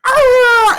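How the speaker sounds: loud, high little yelp; high wolf-pup yelp. Acted. Little wolf pup yelping as it's set down, loud and high. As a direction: let out a sudden squealing yelp. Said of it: "little one's like".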